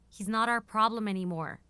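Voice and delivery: exasperated and loud. Acted. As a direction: push back hard at the boss. Heated argument with boss.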